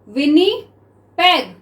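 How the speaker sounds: slow Winnipeg, split as "विनि - पेग"; syllable by syllable. Shown as a demonstration. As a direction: pause inside Winnipeg, before the last syllable. Word broken up while writing it.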